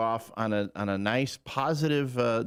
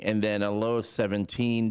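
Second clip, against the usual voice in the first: ordinary talk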